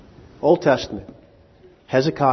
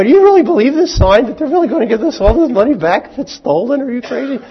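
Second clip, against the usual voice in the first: much higher and louder, fast and mocking; incredulous retort; 12 semitones higher, 8 dB louder, faster than usual. Preacher mocking bank's insurance sign, loud incredulous scoff. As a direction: scoff at the bank sign in disbelief. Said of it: "I go".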